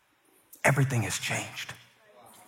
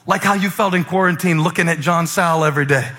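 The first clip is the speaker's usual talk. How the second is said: loud, higher, punchy sermon delivery; full-force preaching; 7 semitones higher, 7 dB louder than usual. Real, not acted. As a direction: belt it out to the congregation.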